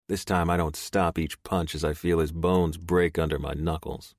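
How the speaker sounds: plain reading voice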